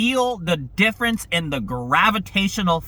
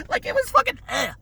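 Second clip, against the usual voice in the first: exasperated laugh. Real, not acted.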